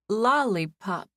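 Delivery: slow, hesitant "Lollipop"; reluctantly. Acted. Guest gives her silly name to the clerk, reluctantly. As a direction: drag the word "Lollipop" out unwillingly, embarrassed. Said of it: "I said reluctantly".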